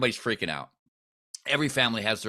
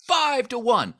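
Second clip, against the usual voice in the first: high, loud delighted exclaim; thrilled at the deal; excited; 13 semitones higher, 5 dB louder, slower than usual. Real, not acted. Delighted at the rate.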